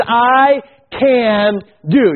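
I can do, each word drawn out slowly; slow emphasis. Shown as a demonstration. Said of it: "Notice the first three words".

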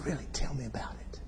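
soft, near-whispered retelling; hushed bedside question. Retold or imitated. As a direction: ask it gently at a bedside. Hushed talk at a deathbed.

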